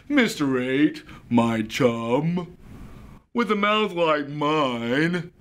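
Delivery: lively storybook reading voice; reading to a toddler. Acted. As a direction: read it aloud to small children. Reads picture book to children.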